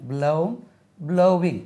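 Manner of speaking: usual speaking voice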